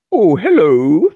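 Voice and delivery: high, loud, drawn-out mock posh British greeting; hammed-up upper-crust British accent; super posh British. Shown as a demonstration. Friend showing what the drunk girl's accent wasn't, super posh British. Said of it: "like, super poshy".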